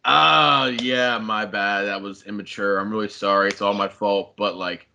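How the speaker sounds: low mock-sheepish admission; half-hearted apology, imitated. Caught-out man owning up to ghosting, retold as low half-hearted apology.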